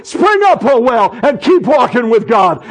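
high, loud command; commanding declaration. Pretend. Speaking to own body, a loud high command. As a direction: order the well to spring up. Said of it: "I go".

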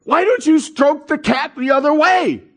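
high-pitched mock parental scolding; voiced fed-up parent; exasperated parent scolding.

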